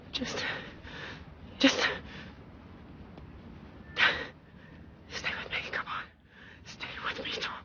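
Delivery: hushed desperate pleading; urgent whisper. Acted. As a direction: whisper to keep him awake. Pleading with a fading man.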